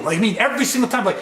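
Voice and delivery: ordinary delivery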